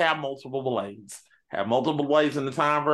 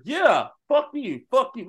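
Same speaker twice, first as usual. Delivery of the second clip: Blades cursing; 10 semitones higher, 4 dB louder than usual. Retold or imitated. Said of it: "We say".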